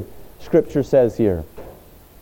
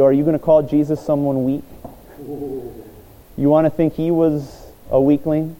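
ordinary delivery

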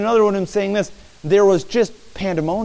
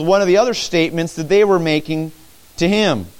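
normal talking voice

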